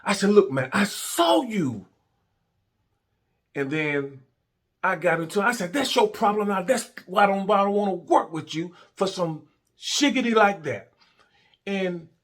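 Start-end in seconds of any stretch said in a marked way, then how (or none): 0.3-1.9 high, loud, drawn-out retold accusation; insistent accusation. Acted quarrel with partner. 5.7-10.9 high-pitched retold rant; indignant telling-off. Telling partner off, acted out.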